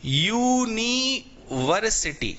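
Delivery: यूनिवर्सिटी drawn out very slowly, syllable by syllable; teacher slowly sounding out a word for the class; slow clear pronunciation. Shown as a demonstration. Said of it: "यहां पे बताओ ए आएगा कि एन आएगा?".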